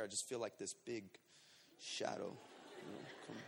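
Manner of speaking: low, fading near-whisper; suspense hush. Real, not acted. Storyteller sensing a big shadow behind him, hushed. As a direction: sink to a murmur as something looms.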